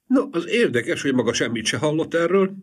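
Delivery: high eager woman's voice; high chatty wife; gossiping wife. A narrator's reading. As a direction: lean in, eager to tell. The wife speaks in character.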